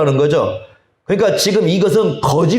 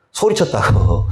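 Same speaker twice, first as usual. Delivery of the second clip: ordinary delivery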